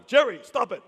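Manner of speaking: high, loud exasperated director; Larry David. Retold or imitated. Director scolding his laughing actor, loud high exasperation. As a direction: call out sharply, cutting off the giggles. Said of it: "And so he says".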